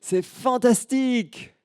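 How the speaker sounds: put-on high, loud, slow child's cry of joy; delighted high-pitched child calling out; little girl exclaiming. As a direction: shout it like a thrilled little girl in the mountains. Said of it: "elle s'écrie".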